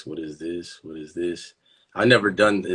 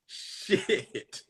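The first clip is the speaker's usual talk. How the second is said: ordinary talk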